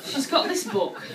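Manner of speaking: ordinary delivery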